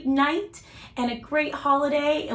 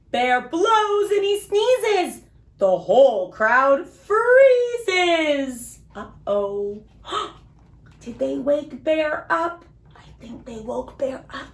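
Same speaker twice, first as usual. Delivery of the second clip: put-on lively, sing-song storybook read for little kids; reading to children; slower than usual. A narrator's reading. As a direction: read it like bedtime story time for small children. Reads picture book to kids.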